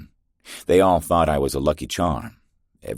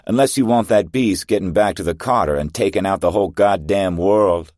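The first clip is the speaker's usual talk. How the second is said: loud, higher urgent yell; shouted order; 5 semitones higher, 6 dB louder than usual. Acted. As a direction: drive the squad on with a shout. Soldier barks order in battle.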